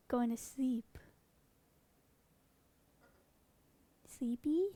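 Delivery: soft hushed whisper so the bird can sleep. Real, not acted. Whispering beside a dozing parrot, hushed. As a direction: breathe the words without voice.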